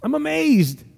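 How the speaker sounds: high-pitched, incredulous astonishment; appalled amazement. Acted. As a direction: lift the pitch in disbelief. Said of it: "He looks on and he says".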